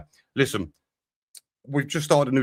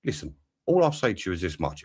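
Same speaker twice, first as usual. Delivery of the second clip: ordinary talk, nothing marked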